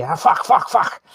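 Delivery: imitating a swearing Dublin taxi driver; imitated Irish cabbie. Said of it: "the first thing the guy will say".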